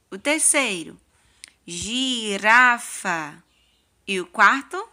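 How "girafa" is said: girafa stretched out very slowly; teacher reading girafa very slowly; slow model word. Shown as a demonstration. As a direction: draw out girafa syllable by syllable. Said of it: "vamos ler com tia cada palavrinha?".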